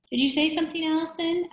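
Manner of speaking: high, loud questioning call; calling through door. A narrator's reading. Visitor asks the girl through the door, high loud call.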